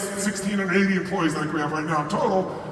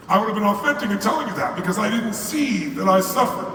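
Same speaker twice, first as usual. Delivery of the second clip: ordinary delivery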